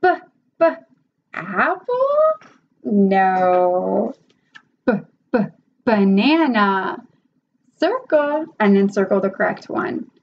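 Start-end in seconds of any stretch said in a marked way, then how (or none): 0.0-8.4 questioning apple, firm no, slow banana; teacher correction demo. Shown as a demonstration. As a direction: redirect gently. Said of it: "if they circle the apple".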